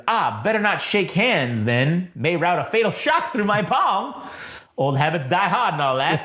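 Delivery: put-on slow, mannered old-time announcer voice; 1930s announcer. Droid declining a handshake, as a 1930s announcer. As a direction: stretch it out like a vintage radio announcer. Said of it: "like the nineteen thirties announcer guy".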